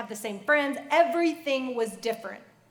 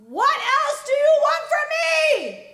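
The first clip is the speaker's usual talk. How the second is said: high, slow shout flung at the sky; desperate cry at the top of the lungs; anguished scream; 11 dB louder, slower than usual. Retold or imitated. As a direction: scream it up to God with everything. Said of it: "screamed at the top of my lungs".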